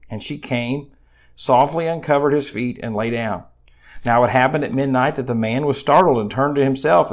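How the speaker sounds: preacher reading scripture aloud. Acted. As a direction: read the passage out to the congregation. Reads Ruth chapter three aloud.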